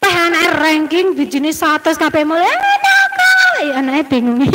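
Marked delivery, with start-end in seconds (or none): 2.5-3.5 very high, slow, drawn-out teasing; playful mock scold. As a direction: stretch it way up high, fondly. Mother teasing praise of child. (teguran manja, sangat tinggi dan lambat)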